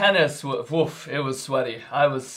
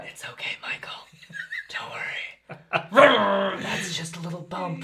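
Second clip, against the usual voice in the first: whispering; 4 semitones higher than usual. Retold or imitated. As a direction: breathe it, teasingly reassuring. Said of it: "Whispering into your ears".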